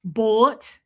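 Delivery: Minnesotan boat and BAYG; long Minnesotan vowels on boat and bag; Debbie Zito. Retold or imitated. Host imitating her friend, Minnesotan accent. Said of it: "She says big".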